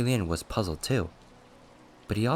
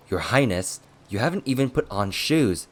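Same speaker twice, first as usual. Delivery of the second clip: urgent remonstrance; 2 semitones higher, 5 dB louder than usual. Acted. Attendant holding back his barefoot master, remonstrating. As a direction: stop him with a raised, scolding voice.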